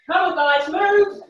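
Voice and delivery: loud, high push to move; rally the class with a shout; shouted encouragement. Real, not acted. Coach urging class on.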